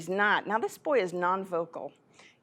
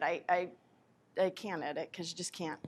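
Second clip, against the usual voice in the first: ordinary talk, nothing marked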